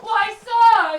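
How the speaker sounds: loud, high exclamation; surprised. Acted. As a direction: burst out in surprise. Shopkeeper's sudden show of respect.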